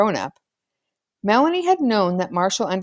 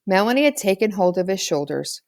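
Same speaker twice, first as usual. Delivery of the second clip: ordinary delivery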